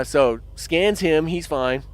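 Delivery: ordinary delivery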